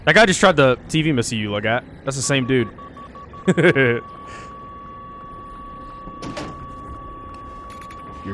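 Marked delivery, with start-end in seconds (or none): 2.7-8.2 squeaky high-pitched giggle; high laugh. Real, not acted. Laughing at the game.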